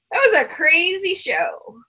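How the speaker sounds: ordinary delivery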